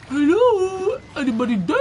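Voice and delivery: loud, high nervous call. Real, not acted. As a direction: call into the empty ward. Calling out in dark corridor.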